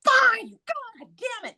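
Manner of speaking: exasperated. Retold or imitated. Reluctant giving in, exasperated.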